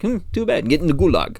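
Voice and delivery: bad Russian accent, high and pushed; hammed-up Russian accent, dismissive. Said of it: "Horrible Russian accent".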